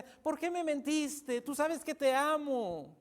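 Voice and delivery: slow, drawn-out acted pleading; gentle pleading. Dalila presses Samsón for the truth, slow coaxing plea. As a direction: stretch each phrase out, imitating a coaxing lover. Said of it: "le empieza a decir suavemente".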